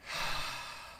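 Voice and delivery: long breathy sigh of exasperation; exasperated sigh. Retold or imitated. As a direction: let out a weary, eye-rolling sigh. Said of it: "And I went".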